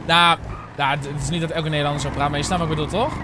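ordinary talk, nothing marked

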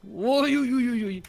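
high, rising panic while slipping; nervous yelps as the foot slides; alarmed. Real, not acted. Slipping on the climb.

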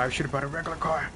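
ordinary talk, nothing marked

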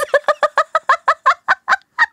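bursts out in high, loud laughter. Real, not acted. Group laughing at the story.

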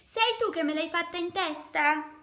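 high, loud squeaked accusation; squeaking. A narrator's reading. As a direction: squeak the question like an indignant little mole. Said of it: "squittì". (domanda squittita)

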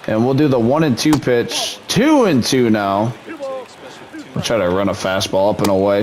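plain. Acted.

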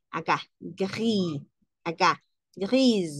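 gris drawn out slowly, ending in a z sound; French gris. Shown as a demonstration. Teacher slowly models gris with a z sound. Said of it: "pero en la pronunciación".

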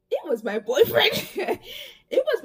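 high laughing reveal. Real, not acted. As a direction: laugh through the punchline.

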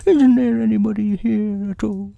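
high, loud bear voice; Pooh. Acted.

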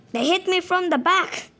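high startled complaint. Real, not acted. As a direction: yelp it out in surprise. Hit in game.

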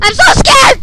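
high, loud frightened cry; scared cry. Real, not acted. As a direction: blurt out in real fear. Scared while playing.

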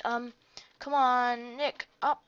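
ordinary delivery

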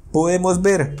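ordinary talk

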